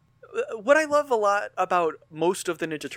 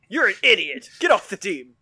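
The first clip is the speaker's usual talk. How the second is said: loud, raised mock scolding; mock angry outburst; 4 semitones higher, 10 dB louder than usual. Playing the scornful reply to a magic suggestion, mock angry shout. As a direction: yell it as an exasperated dismissal.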